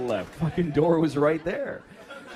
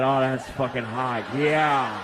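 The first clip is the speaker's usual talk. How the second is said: low, loud, slow sultry moan; husband pretending his wife turns him on; mock lustful husband; 4 semitones lower, 4 dB louder, slower than usual. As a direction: ham up the aroused husband.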